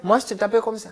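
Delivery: son lightly imitating his scolding mother; man doing a woman's voice. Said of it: "Elle m'appelle, elle dit".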